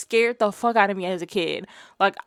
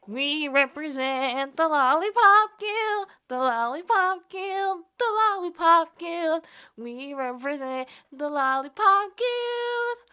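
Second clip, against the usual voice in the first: high, slow squeaky munchkin singsong; 7 semitones higher, 4 dB quieter, slower than usual. Pretend. Bree opening her reaction video as a munchkin, slow and high. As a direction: sing it out like a tiny munchkin.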